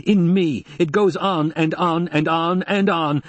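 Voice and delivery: high, strained, drawn-out outpouring; edge of hysteria. A narrator's reading. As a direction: let it spill out slowly, nearing hysteria. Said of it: "she had heard the hysteria edging his voice".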